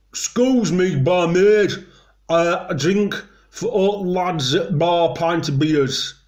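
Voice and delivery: high, broad Yorkshire call to the barmaid; hammed-up northern pub order in thick Yorkshire; Yorkshire accent. Shown as a demonstration. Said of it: "notice the accent".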